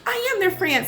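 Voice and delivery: high-pitched child's protest, imitated; imitating small child. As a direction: play a tot insisting. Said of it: "she was like".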